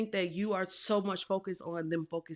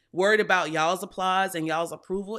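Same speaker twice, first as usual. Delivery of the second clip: normal talking voice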